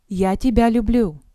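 normal talking voice

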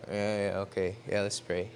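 low, soft, half-hearted agreement; reluctant teammates. Retold or imitated. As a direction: go along warily, without enthusiasm.

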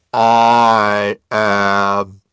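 huge booming divine voice; loud, rolling godlike roar; roaring like the sea. A narrator's reading. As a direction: roar it out, vast and sustained. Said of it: "the voice roars like the sea".

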